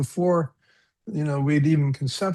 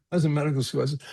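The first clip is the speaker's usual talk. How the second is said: usual speaking voice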